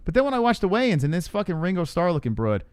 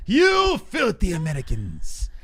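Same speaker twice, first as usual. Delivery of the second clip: slow, higher put-on troll sneer; mock troll voice; 5 semitones higher, slower than usual. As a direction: drag out "filthy Americans" like a hater. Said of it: "People like".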